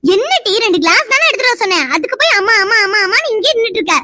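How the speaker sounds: high, scolding, mimicking her whine; annoyed scolding. Mother scolding a daughter who only keeps whining.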